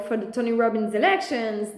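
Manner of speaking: ordinary delivery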